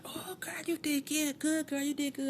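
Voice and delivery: high-pitched congratulating friend; imitated gushing praise. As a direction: imitate a friend cheering her on. Acting a woman praising her afterwards.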